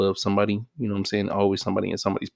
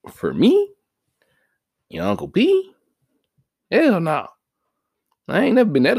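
loud emphatic; 10 semitones higher, 5 dB louder than usual. Real, not acted. Emphatic personal refusal.

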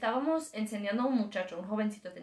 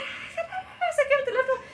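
retold yell; 13 semitones higher, slower than usual. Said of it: "y él empezó a gritar".

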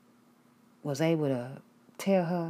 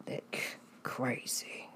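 awkward hushed agreement; 8 dB quieter than usual. Retold or imitated. Her own awkward reply.

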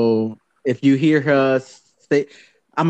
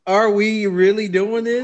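incredulous; 8 semitones higher than usual. Real, not acted. Incredulous at topic.

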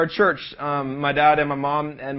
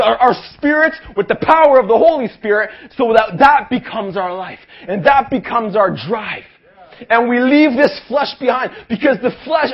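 raised, pumped-up revival sermon; preaching at full force; 6 semitones higher, 8 dB louder than usual. Acted. Youth preacher firing up the young crowd, full preaching voice.

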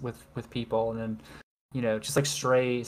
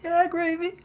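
much higher goofy yeah. Acted. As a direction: squeal it like a cartoon. Goofy high exclamation.

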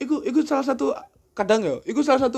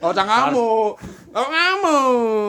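laughing drawl; 5 semitones higher, 6 dB louder, much slower than usual. Pretend. Mocking friend as angry.

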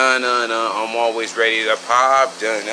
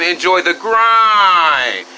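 catchphrase; 5 semitones higher, 6 dB louder, much slower than usual. Acted. Signature sign-off catchphrase.

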